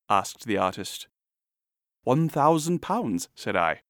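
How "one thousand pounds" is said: measured, slightly slow, higher-pitched answer; matter-of-fact price. A narrator's reading. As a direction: state the price deliberately. Narrator names his price.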